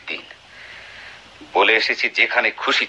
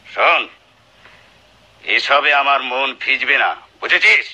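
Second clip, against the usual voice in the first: building to a high, menacing threat; husband threatening his wife, rising anger; 3 semitones higher than usual. Acted. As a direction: threaten her, climbing to murder.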